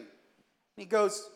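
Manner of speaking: ordinary delivery, nothing marked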